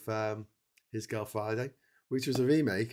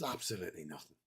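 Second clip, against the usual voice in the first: deadpan flat answer; 5 semitones lower, 8 dB quieter, faster than usual. Acted. Acted film pitch dialogue.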